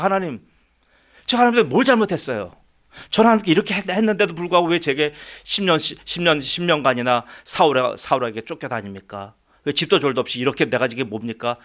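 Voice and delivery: high, fast, heated questioning of God; indignant protest. Retold or imitated. David demanding answers from God after years of flight, heated. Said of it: "따지는 거예요".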